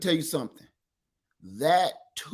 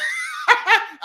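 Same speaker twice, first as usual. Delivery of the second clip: laughing; 13 semitones higher, 4 dB louder than usual. Real, not acted. Laugh inside the impression.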